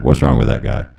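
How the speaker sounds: usual speaking voice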